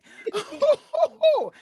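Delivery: high, loud burst of laughter. Real, not acted. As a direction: crack up loudly and high. Laughs at the coffin remark.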